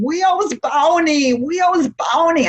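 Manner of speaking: put-on high slow child voice saying Wheels Boney; little girl who can't say her R's; imitating a toddler's lisping request; toddler mispronouncing. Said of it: "she couldn't say her R's".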